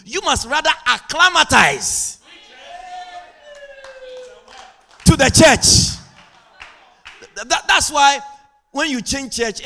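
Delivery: high, loud, driving sermon voice; impassioned preaching. Real, not acted. Preacher telling church members to adapt, full-force preaching.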